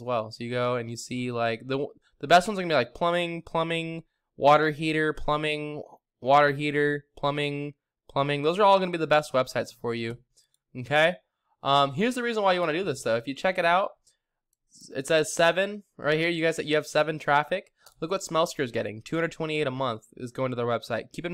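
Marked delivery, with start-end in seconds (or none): none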